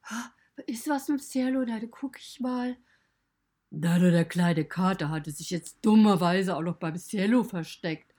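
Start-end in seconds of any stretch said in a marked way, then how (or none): 0.0-2.8 higher, fretting woman's voice; anxious oh-Gott fussing; Petra the owner. Retold or imitated. Owner coming back worried about the pony, higher and fretting.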